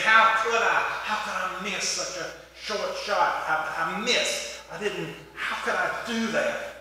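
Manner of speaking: hammed-up frustration without real shouting; mock tirade. Golfer cussing after throwing his club, mock tirade. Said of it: "he goes into a tirade again".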